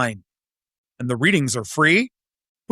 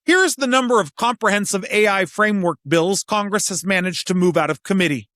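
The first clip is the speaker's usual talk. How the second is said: normal talking voice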